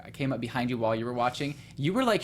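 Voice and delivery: ordinary delivery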